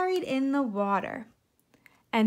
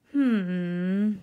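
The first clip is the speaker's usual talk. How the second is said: thoughtful hmm; 2 semitones lower, 5 dB louder than usual. A narrator's reading. Fox ponders.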